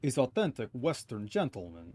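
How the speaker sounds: put-on huffy Russian-accented sailor. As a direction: play a stubborn Russian crewman. Voices Russian sailor character.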